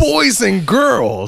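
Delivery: hammed-up ringmaster opening the show; ringmaster call. Mock circus ringmaster opening show.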